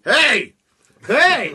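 loud, high panicked shout; trapped man yelling. Retold or imitated. Trapped old man shouts from inside the porta potty. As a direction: yell from inside the locked toilet. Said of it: "he fucking started".

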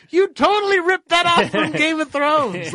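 high, loud, slow disbelief; incredulous. Real, not acted. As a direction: exclaim in amused disbelief. Laughing outrage at fan fiction.

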